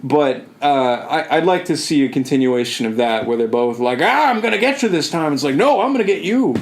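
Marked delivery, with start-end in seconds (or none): 4.0-5.3 playful threat, pitched high; mock battle cry. Imagined primarchs threatening each other. 5.6-6.6 high-pitched playful retort; mock counter-threat. Imagined rival answering the threat.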